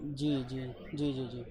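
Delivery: low, soft, polite acknowledgements; subdued deference. Real, not acted. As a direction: murmur respectful agreement to the caller, pitched well down. Deferential replies on a phone call.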